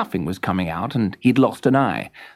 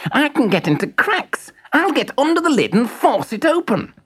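much higher thin toy voice; eager screwdriver. Acted. Screwdriver offering to pry the lid, much higher. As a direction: sound keen and clever like a small tool. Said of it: "said the screwdriver".

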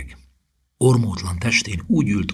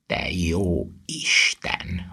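slow, horrified exclamation; appalled, stretched-out gasp of words; aghast; much slower than usual. A narrator's reading. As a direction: draw it out in dawning horror. Ron pales at the scream.

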